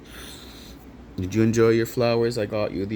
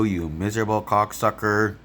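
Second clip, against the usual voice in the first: ordinary talk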